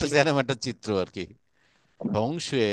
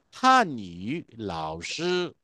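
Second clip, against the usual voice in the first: Honey লাওশি drawn out slowly; slow vocabulary model; 6 semitones lower, 3 dB quieter, much slower than usual. Shown as a demonstration. Teacher slowly pronounces the new word for students. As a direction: stretch honey লাওশি syllable by syllable. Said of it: "teacher introducing new word".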